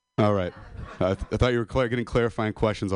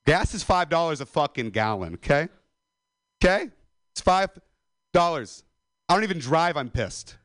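mock rant; 8 semitones higher than usual. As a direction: whip yourself into a mock fury about prices. Comedic rant building to anger.

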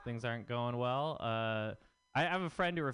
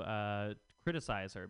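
ordinary talk

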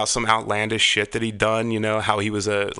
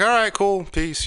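high casual woman's voice; 10 semitones higher, slower than usual. Retold or imitated. Voicing her casual reply.

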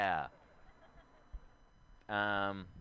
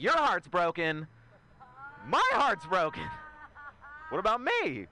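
mock indignant; 5 semitones higher than usual. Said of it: "Yeah, I was pissed off".

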